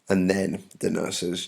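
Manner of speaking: usual speaking voice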